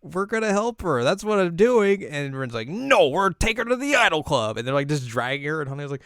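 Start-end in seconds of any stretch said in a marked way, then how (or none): 0.0-2.0 high, firm quarrelling voice; insistent retort. Retold or imitated. Acted argument reply. 2.7-4.6 high, loud bossy retort; heated refusal. Retold or imitated. Acted quarrel over the girl.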